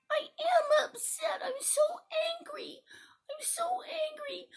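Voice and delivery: high, acted childish indignation; upset. Child telling teacher about the taken book, upset. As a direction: complain like a wronged child. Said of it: "He looks so upset".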